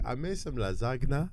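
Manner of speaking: imitating mom's Nigerian accent, soft; "lasagna" said as "nazagna", gentle Nigerian accent. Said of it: "That's a good accent".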